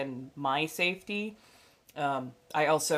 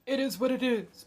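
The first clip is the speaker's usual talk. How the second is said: dismissive shrug; 7 semitones higher than usual. Acted. Shrugging off bad actors.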